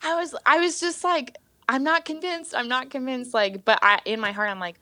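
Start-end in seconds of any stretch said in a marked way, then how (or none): 1.7-3.3 stubborn disbelief. Retold or imitated.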